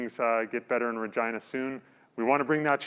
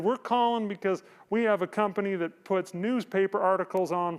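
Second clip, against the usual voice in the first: higher, mock Arkansas sales pitch accent; Arkansas telemarketer accent; 5 semitones higher than usual. Host retelling the plaque sales pitch in a mock Arkansas accent. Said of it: "that's a horrible Arkansas accent".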